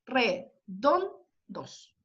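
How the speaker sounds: "Redondos" spoken very slowly with the weight on "don"; stressed syllable drawn out. Shown as a demonstration. Teacher slowly showing the class that "Redondos" is stressed on "don". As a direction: stretch out "Redondos" and lean on "don". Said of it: "La carga de voz está en don".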